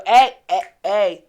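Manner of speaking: sharp loud calls to stop everyone; mom yelling orders. Retold or imitated. Daughter imitating her mom shouting the room to order, loud. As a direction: bark it out like a bossy mother taking over.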